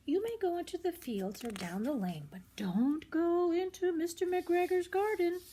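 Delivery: a mother rabbit cautioning her kits; mother rabbit warning. Acted.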